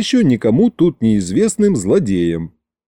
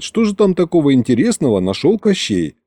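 usual speaking voice

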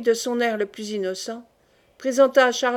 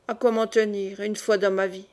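ordinary delivery, nothing marked

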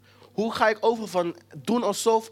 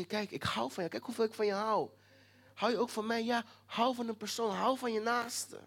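softer, gentle voice of God; tender; 7 dB quieter than usual. Acted. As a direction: speak it softly, like a loving father. God speaks lovingly to listener. (God spreekt zacht en liefdevol)

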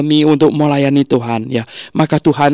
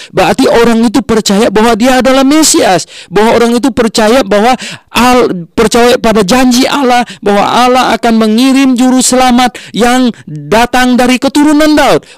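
high, driving preacher's voice; fervent preaching; 9 semitones higher than usual. Acted. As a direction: preach it with rising fervour. Preaching at full force.

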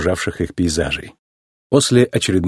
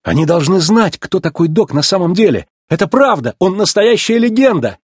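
high, urgent pleading to be believed; earnest, high-pitched persuasion; desperate insistence; 7 semitones higher than usual. A narrator's reading. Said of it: "настаивал Маккуин на грани отчаяния".

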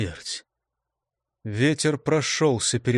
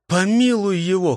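pleading shout, higher and louder; shouted plea; 6 semitones higher, 4 dB louder than usual. A narrator's reading. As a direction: cry out for mercy. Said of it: "крикнул он ветру".

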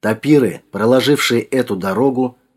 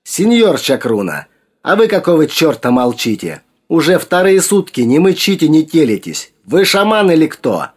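higher, indignant demand; lashing out; 7 semitones higher, 4 dB louder than usual. A narrator's reading. Sergei rounds on the silent shaman, lashing out. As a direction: pitch climbing as you berate him. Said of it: "обрушился на Ильича".

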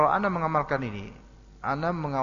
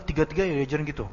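ordinary delivery

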